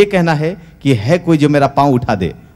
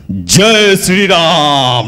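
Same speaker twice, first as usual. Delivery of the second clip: battle cry; much slower than usual. Acted. Hanuman leaps with battle cry.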